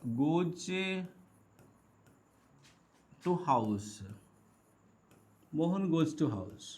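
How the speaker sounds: slow words with long gaps, falling low; slow dictation. Real, not acted. Teacher writing an example sentence, slow dictation. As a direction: say each word as you write it.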